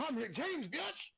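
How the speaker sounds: high swaggering shout of the line, biatch shooting way up. Retold or imitated. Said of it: "I said".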